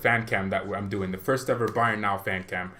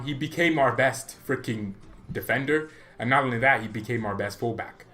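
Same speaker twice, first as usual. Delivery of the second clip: ordinary talk, nothing marked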